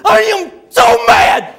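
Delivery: hammed-up furious bellow, tongue-tied; high, slow, loud mock rage with mangled speech; worked-up Buddy. Buddy tells the preacher how he feels, worked up and bellowing. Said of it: "when he gets real worked up, he can't talk very good".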